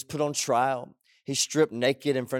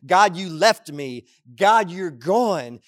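loud, slowing acted cry at God; angry accusation; 3 semitones higher, 6 dB louder than usual. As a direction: shake a fist at the sky, stretching it out. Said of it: "shake your fist at the sky and".

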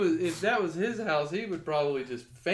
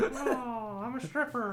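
stripper voice; 9 semitones higher, slower than usual. Pretend. Joking stripper name voice.

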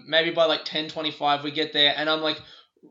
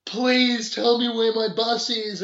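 begging; 7 semitones higher, 6 dB louder, slower than usual. Retold or imitated. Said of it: "begging people".

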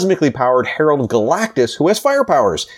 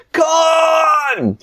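high, drawn-out wail to the heavens; hammed-up anguished cry; mock dramatic scream; 13 semitones higher than usual. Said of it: "she screams to the heavens".

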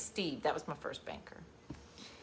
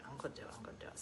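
whispered pep talk; 4 semitones lower, 18 dB quieter, much faster than usual. Retold or imitated. Retold self-pep while signing.

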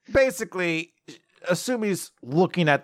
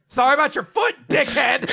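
mock angry yell; 8 semitones higher than usual. Jealous girl snaps at him.

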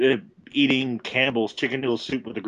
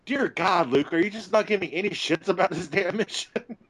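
exasperated me; 7 semitones higher than usual. Retold or imitated. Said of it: "And I'm like".

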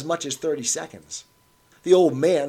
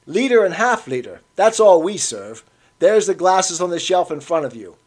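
louder, curt barman; dismissive barman; 5 semitones higher, 8 dB louder than usual. A narrator's reading. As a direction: wave the old man toward the shelf. Barman dismissing the old man.

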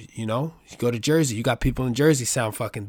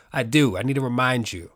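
usual speaking voice

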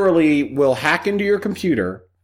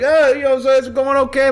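much higher wise-guy chatter; goomba voice; 11 semitones higher than usual. Retold or imitated. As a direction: play the friendly neighborhood mobster. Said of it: "He's like".

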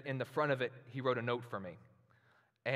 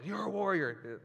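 a higher, clipped imitation of a cop barking it; mock the officer's blunt delivery, lightly; police officer voice; 7 semitones higher, 4 dB louder than usual. Pastor imitating how his police officer friend talks, light mock bark. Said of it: "he's a police officer, so that's how he talks".

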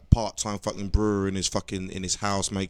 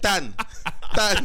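Alan Partridge shout; 8 semitones higher, 3 dB louder than usual. Retold or imitated. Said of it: "it's like Partridge".

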